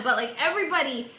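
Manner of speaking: ordinary talk, nothing marked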